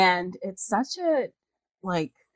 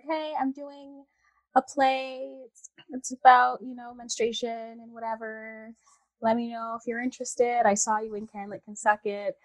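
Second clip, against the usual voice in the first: put-on friend voice; 5 semitones higher, 3 dB quieter than usual. Messaging a castmate to offer her a play, put-on voice. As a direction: quote her in a high, slowed put-on voice. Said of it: "Why am I giving her that voice?".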